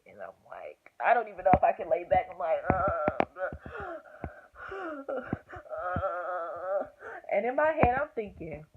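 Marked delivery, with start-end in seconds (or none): 2.7-6.8 drawn-out, tearful mock moan; imitated labor groan. Trying to lay back for the check, imitated groan. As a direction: re-enact groaning through pain. Re-enacting pain while lying back.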